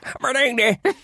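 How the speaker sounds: high-pitched mangled mock-Jamaican outburst; botched Jamaican accent. Friend imitates singer's accent fail, mock Jamaican bleat. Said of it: "for me, that is just".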